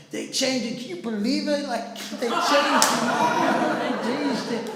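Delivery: high, loud, laughing disbelief; hammed-up, squealing astonishment; imitated shock. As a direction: mimic a man amazed beyond belief. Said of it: "He was, like, shocked".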